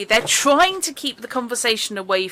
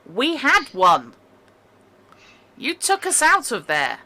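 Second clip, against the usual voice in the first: indignant prisoner; 6 semitones higher, 6 dB louder than usual. Acted. Prisoners protest being jailed.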